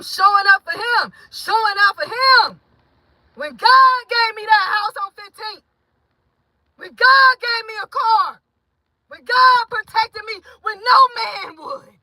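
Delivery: high and loud, voice cracking with tears; tearful shouting testimony. Real, not acted. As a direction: cry out your thanks to God. Thanking God for protection.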